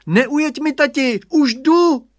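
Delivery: high, loud call from the window; boy yelling to his dad outside; child's high shout. A narrator's reading. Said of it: "se vyklonil z okna a volal".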